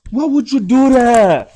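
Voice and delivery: loud high slow plea; pleading shout. Real, not acted. Boyfriend pleading with his girlfriend after the prank, loud and slow. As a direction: beg her to explain, loudly.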